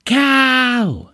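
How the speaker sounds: high drawn-out yell; acted shout at a passing driver. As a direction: yell it out long, as if from a passing car. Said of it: "this guy shouts to her".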